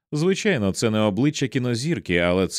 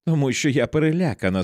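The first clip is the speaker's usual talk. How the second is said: narrated anger, pitched up; angry exclamation; 6 semitones higher, 3 dB louder than usual. A narrator's reading. Frightened wife answering why she shouts, angry exclamation. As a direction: blurt it out crossly, voice raised in pitch. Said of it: "сердито вигукнула вона".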